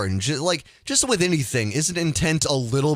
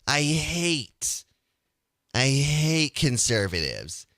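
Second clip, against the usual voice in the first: mock guilt-ridden liberal whine, drawn out at the end; slower than usual. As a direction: do a hammed-up whiny liberal.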